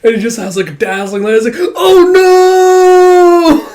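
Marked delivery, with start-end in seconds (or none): 1.8-3.7 long, high, loud mock wail; dismayed wail. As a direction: cry out in hammed-up horror. Dazzled victim cries out.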